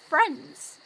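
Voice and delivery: loud, mocking "Friends?"; scornful. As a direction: spit it back in scorn. Scornful reply to an offer of friendship.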